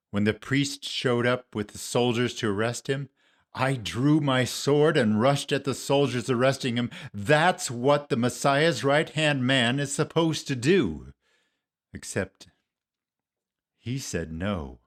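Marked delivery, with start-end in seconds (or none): 3.5-11.1 louder, higher, heated retelling; impassioned self-justification. Acted. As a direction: insist you did your duty. Recounts drawing sword, justifying himself.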